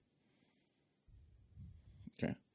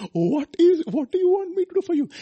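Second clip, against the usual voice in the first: much higher, gentle questioning voice; tender high question; 5 dB louder than usual. Acted. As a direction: ask it as if leaning close. Voicing Jesus' question.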